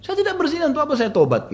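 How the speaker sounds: very high, impassioned denial; mock-tearful indignant outburst; retold tearful protest. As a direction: cry out innocence, pitch way up. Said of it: "Sambil menangis dengan suara keras".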